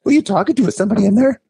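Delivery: very high-pitched curious dog voice; high, comic pet voice asking questions; talking dogs. Acted. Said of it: "They're like".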